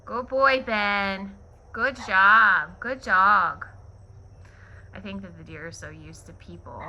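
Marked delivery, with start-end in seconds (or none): 0.1-3.7 praising the dog. Real, not acted.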